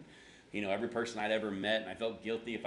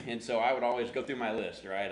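ordinary talk, nothing marked

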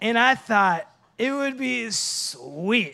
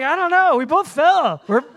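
high, loud mock-panicked protest; hammed-up innocent excuse; feigned innocence; 6 semitones higher, 5 dB louder than usual. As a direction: play dumb, pitched up and overdone. Feigning innocence after the fall.

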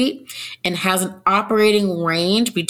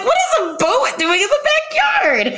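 high, laughing disbelief; laughing confused question. Retold or imitated. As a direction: giggle through the question. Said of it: "I was like".

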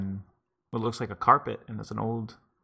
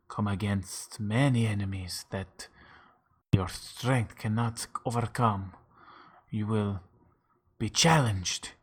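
mock mystical; slower than usual. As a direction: intone the fate slowly like a seer.

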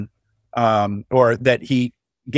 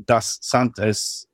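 fake Spanish and German; 3 dB quieter, much slower than usual. Shown as a demonstration. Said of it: "That was a mixture of Spanish and fake Spanish and fake German".

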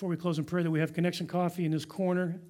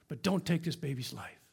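ordinary delivery

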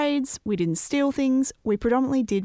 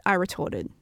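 plain reading voice

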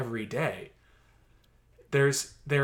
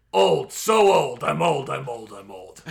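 put-on very old man; 8 dB louder than usual. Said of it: "And he goes".

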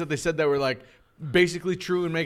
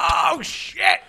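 shocked shout; 12 semitones higher than usual. Retold or imitated. A viewer reacting to the Lethal Weapon twist with a shocked shout. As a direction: yelp it like the twist just hit you.

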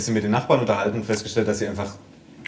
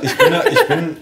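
squeaky high giggling stammer; laughing; 5 dB louder than usual. Real, not acted. Laughing at own hand joke.

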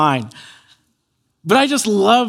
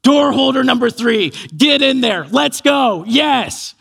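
high, punchy self hype-up; eager pep-talk in short bursts; overjoyed; 5 semitones higher than usual. Retold or imitated. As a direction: imitate an elated kid pumping himself up. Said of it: "were just overjoyed".

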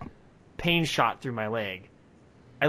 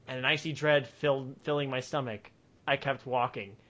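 usual speaking voice

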